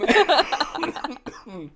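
high laugh into a yawn. Real, not acted. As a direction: laugh until yawning. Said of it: "I'm yawning over here from laughter".

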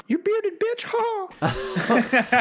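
high-pitched mock taunt; playful squawked jab; mock insult. Mock insult closing his story.

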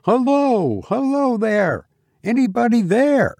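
narrator's high calling voice, not full volume; young man's calling shout. A narrator's reading. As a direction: call out to see who's there. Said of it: "young Reggie's voice shouting".

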